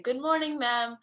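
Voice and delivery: ordinary talk, nothing marked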